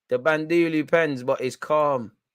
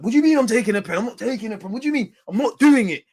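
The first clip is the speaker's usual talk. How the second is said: imitating panicked refusal; 7 semitones higher, 6 dB louder, faster than usual. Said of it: "He's like".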